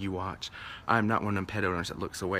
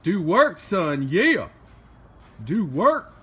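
loud, high cheer with swooping pitch; excited cheering; 7 semitones higher, 7 dB louder, much slower than usual. Real, not acted. Owner egging on the dog, excited cheering. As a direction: hype him up with raised, punchy shouts.

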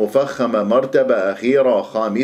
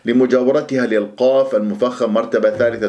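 ordinary talk, nothing marked

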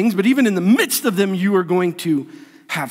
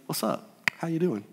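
very soft, low, cool hello; nonchalant greeting; 4 semitones lower, 11 dB quieter than usual. Pretend. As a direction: greet enemies as if nothing is wrong. Diner casually greets his enemies.

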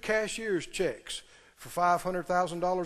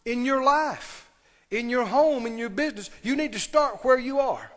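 measured preaching; 3 semitones higher than usual. Acted. Preaching a list slowly.

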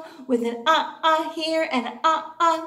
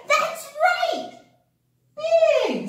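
excited praise; 14 semitones higher, 5 dB louder, much slower than usual. Acted. Praising child's answer.

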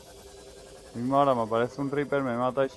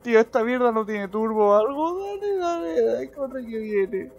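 frantic high urging; being chased; 11 semitones higher, 5 dB louder than usual. Real, not acted. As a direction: push it faster in panic. Urging the sub to go faster.

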